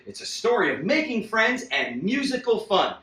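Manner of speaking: ordinary delivery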